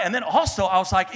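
ordinary talk

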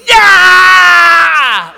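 big, high shout; brother's jump-scare yell. Retold or imitated. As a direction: leap out and roar it. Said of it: "Like that".

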